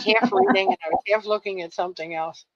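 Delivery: normal talking voice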